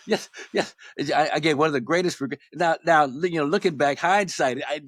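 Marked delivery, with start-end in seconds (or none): 0.1-0.8 excited yes, whispered through a laugh; breathless eager yes. Retold or imitated. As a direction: gasp out a thrilled yes, higher.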